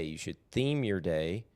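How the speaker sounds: ordinary talk, nothing marked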